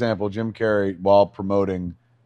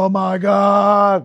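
slow scared gasp; 9 semitones higher, 4 dB louder, much slower than usual. Retold or imitated. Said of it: "and it's like".

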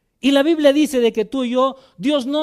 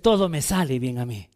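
calm, lower, confident affirmation; positive affirmation; 9 semitones lower, 4 dB quieter than usual. Retold or imitated. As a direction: say it like you believe it, settled and low. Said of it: "¿Por qué no dices". (afirmación tranquila y segura)

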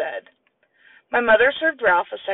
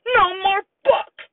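angry yell; 13 semitones higher, 4 dB louder, slower than usual. Acted. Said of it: "he yelled".

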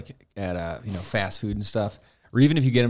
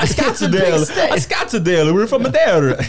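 put-on higher, louder thick accent, slow on the second Scottsdale; mock accent; 8 semitones higher, 8 dB louder, slower than usual. Customers naming their hometown, mock accent. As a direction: insist on Scottsdale in a hammed-up accent. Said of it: "Where's your accent from?".

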